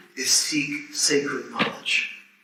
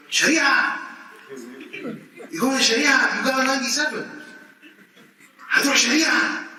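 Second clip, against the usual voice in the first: incredulous administrator; 8 semitones higher than usual. Retold or imitated. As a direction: play the shocked official yelling the word Sharia. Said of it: "He's like".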